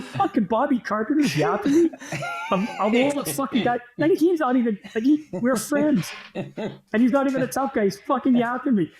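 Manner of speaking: high-pitched, incredulous retold complaint; indignant disbelief. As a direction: act out baffled outrage at a friend. Retold indignation at being heckled.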